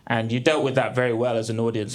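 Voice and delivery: ordinary delivery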